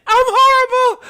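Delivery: high, loud helpless laughter; laughing. Real, not acted. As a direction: laugh through the words. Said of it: "I don't know why I'm laughing".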